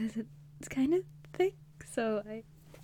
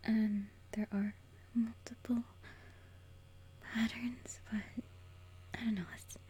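whispering. Real, not acted. Whispers throughout.

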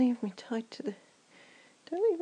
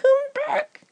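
high, loud yell; desperate shout; 13 semitones higher, 9 dB louder than usual. Acted. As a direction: call him back at full voice. Yelling for partner.

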